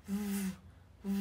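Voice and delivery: voiced V held as a long buzz; V rung cổ. Shown as a demonstration. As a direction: hum the V sound with the throat vibrating. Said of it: "chúng ta sẽ rung cổ nhá". (âm V rung dây thanh, kéo dài)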